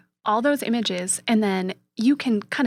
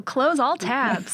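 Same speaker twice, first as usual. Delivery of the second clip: slow, deliberate command to the phone; 4 semitones higher, much slower than usual. Shown as a demonstration. Showing the phone command, slow and deliberate. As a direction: spell it out for the assistant. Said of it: "What is that?".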